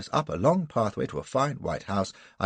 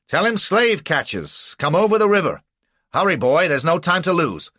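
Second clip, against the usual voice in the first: high, loud rustic man barking orders; rally the neighbours, loud and quick; urgent townsman; 6 semitones higher, 6 dB louder than usual. A narrator's reading. Townsman voiced, giving urgent orders.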